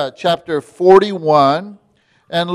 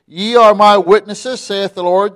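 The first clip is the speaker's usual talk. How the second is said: scripture reading; 3 semitones higher, 4 dB louder than usual. Acted. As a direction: read the verse out as a preacher. Reads scripture aloud.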